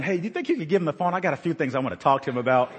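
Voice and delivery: fast, playful request; quick joking ask. Retold or imitated. Father joking to his son to pass Obama the phone, fast. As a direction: rattle it off as a cheeky joke. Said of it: "I remember saying".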